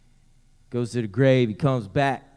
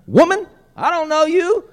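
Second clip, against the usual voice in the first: very high, loud, comic Albemarle denial; hammed-up Albemarle accent; 9 dB louder than usual. Preacher mocking Peter's denial to the woman, hammed-up Albemarle accent. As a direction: squeal it in an exaggerated Albemarle accent, rising at the end. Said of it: "Sound like he's from Albemarle".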